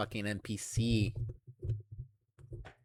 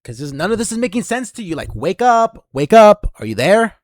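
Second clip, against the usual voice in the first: higher, louder, faster shout; wake-up shout at a zoned-out listener; yelling wake up; 8 semitones higher, 13 dB louder than usual. Acted. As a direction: bellow at someone to snap out of it.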